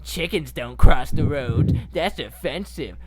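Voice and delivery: mock outrage, drawn out at first; put-on indignant objection; mock offended. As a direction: play someone absurdly offended by a joke. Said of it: "you're not like".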